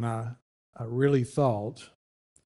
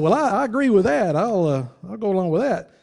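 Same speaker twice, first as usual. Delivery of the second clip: eager agreement; 8 semitones higher, 7 dB louder than usual. Retold or imitated. Own reply voiced, higher.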